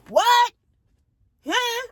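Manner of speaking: very high squeaky what; mock disbelief. Narrator re-enacting her shocked reaction.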